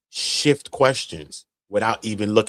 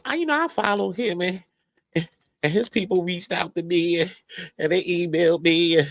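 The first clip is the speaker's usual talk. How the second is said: mocking her claim; 7 semitones higher than usual. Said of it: "you over here lying to them".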